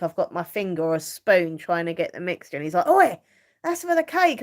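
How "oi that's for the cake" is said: higher, louder mock scolding; playful telling-off. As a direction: imitate him calling out a sharp "Oi". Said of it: "he tells me off".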